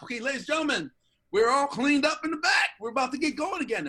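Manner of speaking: high, loud crowd-hyping announcer; warm-up announcer. Retold or imitated. Warm-up comic to waiting studio audience, hyped announcer voice. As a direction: re-enact pumping up the studio audience.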